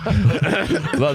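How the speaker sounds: normal talking voice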